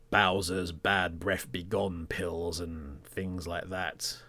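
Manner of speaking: ordinary talk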